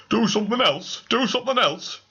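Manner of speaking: high, excited pleading; eager urging. Acted. Mister Grip Throttle urging the ghost, high eager plea.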